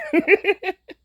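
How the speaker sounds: short high laugh; a quick, high-pitched giggle; self-conscious laugh. Real, not acted. Admitting to viewers she hides in a corner, self-conscious laugh.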